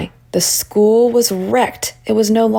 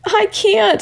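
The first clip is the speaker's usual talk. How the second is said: mild sobbing; 14 semitones higher than usual. A narrator's reading.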